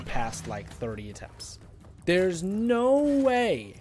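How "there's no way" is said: disbelieving whine. Real, not acted. Disbelief at a death.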